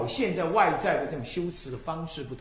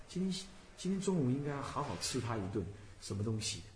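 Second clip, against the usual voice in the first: quiet musing to oneself; low-key mock daydream; idle inner thought; 4 semitones lower, 9 dB quieter than usual. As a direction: let a stray thought drift in, softly. Voices a stray thought during recitation.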